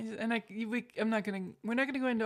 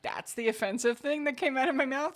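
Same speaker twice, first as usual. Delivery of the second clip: mock-offended Southern accent; terrible Southern accent; 3 semitones higher, 5 dB louder than usual. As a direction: protest in a bad Southern accent. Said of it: "I do a terrible Southern accent".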